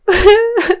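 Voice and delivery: a high, breaking sob; a sob bursting out of her; tearful sob. Acted. Grieving girl to her friends, high sob.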